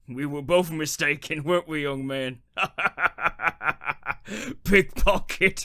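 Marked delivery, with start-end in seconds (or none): 2.5-4.6 sly cackle. Acted. Gravedigger gloats. 4.6-5.7 high, loud and slow, savouring the word; gloating over the young man's blunder; a sneering, slowed-down jeer; mocking. Gravedigger mocks the word.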